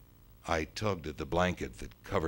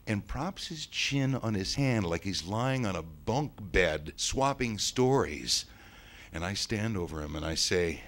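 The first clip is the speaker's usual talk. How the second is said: usual speaking voice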